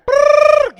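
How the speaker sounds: loud, high rolled brrr. Retold or imitated. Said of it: "go get [name] with it".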